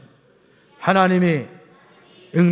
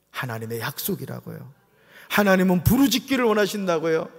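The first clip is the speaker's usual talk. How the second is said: normal talking voice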